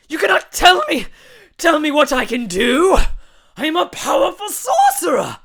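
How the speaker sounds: high, loud, slow manic villain; mad sorcerer. Acted. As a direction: rant like a crazed wizard. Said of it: "George laughed hysterically".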